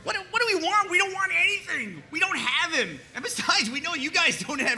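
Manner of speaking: high indignant shouting; exasperated shout across the field; incredulous yell. Acted. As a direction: shout back in disbelief. Yelling back in disbelief.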